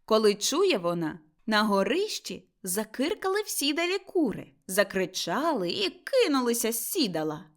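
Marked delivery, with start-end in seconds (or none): none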